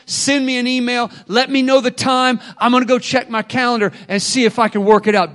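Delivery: usual speaking voice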